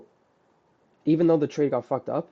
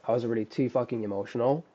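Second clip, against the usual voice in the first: ordinary talk, nothing marked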